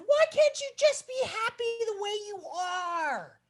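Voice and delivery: high, earnest little warthog voice; small pleading voice. Acted. Little warthog asks the animals to be content, high and pleading. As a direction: plead gently but high. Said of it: "looked around and said".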